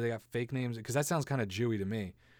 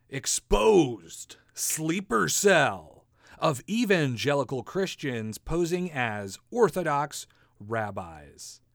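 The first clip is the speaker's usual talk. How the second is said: dramatic headline; 3 semitones higher, 8 dB louder, much slower than usual. Acted.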